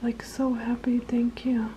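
soft whispered thanks. Real, not acted. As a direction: breathe the thanks tenderly to the little bird.

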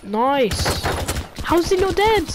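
high, loud urgent cheering; excited gamer. Real, not acted. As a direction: cheer on the teammate. Watching teammate fight.

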